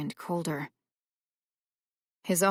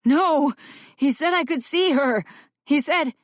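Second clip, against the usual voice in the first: desperate protest; 9 semitones higher, 6 dB louder than usual. A narrator's reading.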